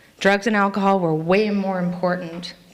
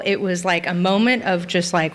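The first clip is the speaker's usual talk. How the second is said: normal talking voice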